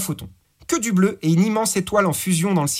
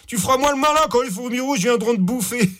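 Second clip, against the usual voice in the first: laughing through the threat, higher; laughing taunt; 7 semitones higher than usual. A narrator's reading. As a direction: cackle as you threaten. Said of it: "Tino rigola".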